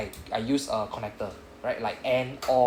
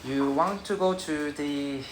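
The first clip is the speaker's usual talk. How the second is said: Japanese English accent; 2 semitones higher, slower than usual. Shown as a demonstration. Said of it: "I speak English like Japanese also".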